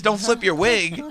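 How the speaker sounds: high, prissy put-on voice; mock Rose. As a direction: scold him like a prim lady. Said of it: "she'll say this".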